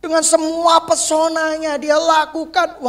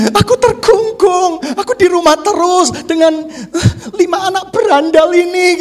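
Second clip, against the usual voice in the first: mock trapped wife; 6 semitones higher, 5 dB louder than usual. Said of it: "teriak-teriak".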